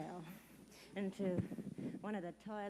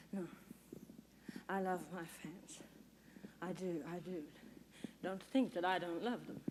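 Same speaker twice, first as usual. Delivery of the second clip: soft, low and earnest; hushed, low-pitched insistence; subdued reassurance; 5 semitones lower, 11 dB quieter than usual. Acted. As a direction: say it softly, backing down after an outburst. Star reassures about loving her fans.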